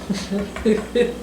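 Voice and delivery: a short soft breathy chuckle; soft laugh. Real, not acted. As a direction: laugh quietly at yourself. Laughs at own idea.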